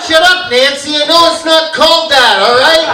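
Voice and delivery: comic telling-off of a heckler in the crowd; snapping at heckler. Real, not acted. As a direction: bark it at the front row.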